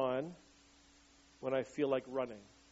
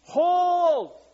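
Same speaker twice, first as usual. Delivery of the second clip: much higher, much louder shouted "Hold!"; battle scream; 14 semitones higher, 13 dB louder than usual. Retold or imitated. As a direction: scream it to soldiers holding the line. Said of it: "he just keeps screaming to his men".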